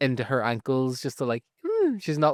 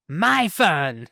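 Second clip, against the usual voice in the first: louder, higher triumphant line; snatch the word back from him; punchy mock shout; 6 semitones higher, 5 dB louder than usual. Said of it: "She's like".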